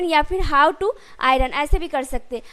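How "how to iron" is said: very slow, word by word; slow, careful model sentence; slow dictation. Shown as a demonstration. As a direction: spell it out slowly for the class. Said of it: "teacher models the English sentence".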